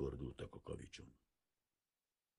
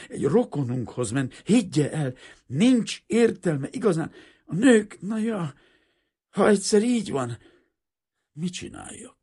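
much higher and louder, reproachful; 13 semitones higher, 13 dB louder than usual. A narrator's reading. Breathless father reproaches Kazimír, not angry but aggrieved. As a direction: complain more than rage. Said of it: "inkább szemrehányon, mint haragosan".

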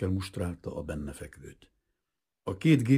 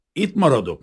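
stubborn refusal; 9 semitones higher, 10 dB louder than usual. A narrator's reading. Captain refuses hospital.

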